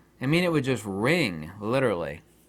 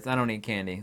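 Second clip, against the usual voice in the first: ordinary talk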